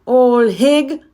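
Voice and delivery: loud, high accusation; accusing. Acted. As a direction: hurl the name at her. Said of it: "said Miriam".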